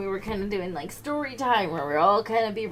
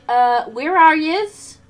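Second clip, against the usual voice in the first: slow, higher and much louder; feigned confusion; 7 semitones higher, 15 dB louder, much slower than usual. Retold or imitated. A pupil caught reading ahead asks where the class is, slow mock daze. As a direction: play dumb, dragging it out. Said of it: "And I'm like".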